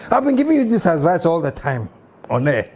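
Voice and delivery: normal talking voice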